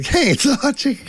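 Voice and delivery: high-pitched mock fan call; excited fan. Fans spotting him at the restaurant, high mock excitement. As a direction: squeal it lightly like someone spotting a local celebrity. Acting out people who recognize him.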